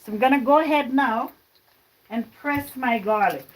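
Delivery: ordinary talk, nothing marked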